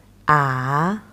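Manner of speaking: "啊" slow, dipping low then rising; third tone. Shown as a demonstration. Teacher showing students the dipping third tone. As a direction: let "啊" dip down and come back up. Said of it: "And third one is".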